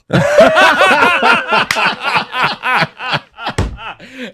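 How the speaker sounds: long, loud, high laughter; real belly laugh. Real, not acted. Driver cracking up at the officer's admission, big laugh. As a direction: burst out laughing at the punchline.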